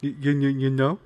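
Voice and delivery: a hint of a Jersey cab driver accent; Jersey cabbie accent. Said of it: "that Jersey guy who drives a cab".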